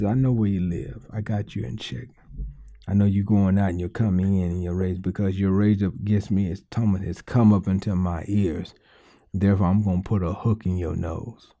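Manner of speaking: low, stern voice of God. Acted. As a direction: speak as God, low and steady. God speaking to enemy.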